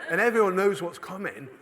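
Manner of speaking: usual speaking voice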